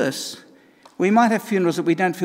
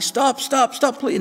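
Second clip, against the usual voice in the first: higher, begging stops; pleading cup; 10 semitones higher, slower than usual. Acted. Cup back in the furnace begging, mild high pleading. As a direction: plead with the potter again. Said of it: "and I'm saying".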